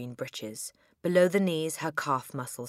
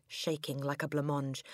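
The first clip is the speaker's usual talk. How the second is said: plain reading voice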